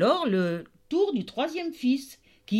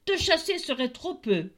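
higher, threatening outburst; the tailor threatens the goat; angry tailor; 8 semitones higher than usual. Acted. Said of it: "s'écria-t-il". (menace furieuse du tailleur)

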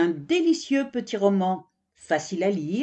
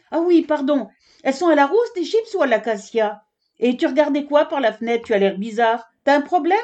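put-on much higher, louder child's voice; little girl giraffe; 7 semitones higher, 4 dB louder, faster than usual. Little giraffe Kika begs her mother for chips, high and eager. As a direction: bounce in like an eager little kid. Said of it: "une petite girafe".

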